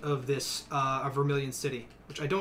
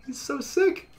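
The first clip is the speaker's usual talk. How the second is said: much higher, louder giddy hype; excited squealing; 4 semitones higher, 6 dB louder than usual. Real, not acted. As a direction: gush over the stats. Celebrating Wumbus Phil's stats.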